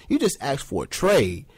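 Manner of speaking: ordinary talk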